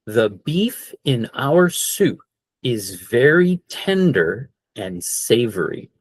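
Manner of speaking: slow careful sentence for learners; teacher reading a sentence slowly; slow model sentence. Shown as a demonstration. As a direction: read it slowly, word by word. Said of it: "Full speed".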